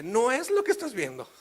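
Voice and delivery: high, loud protest; panicked denial. Acted. Caught husband excusing himself to wife, panicked denial. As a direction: plead loudly that you are innocent.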